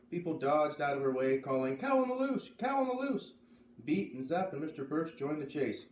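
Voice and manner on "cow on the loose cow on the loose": high, loud alarmed call. Acted. Crowd at the fair calling a warning. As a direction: shout the warning across the barn.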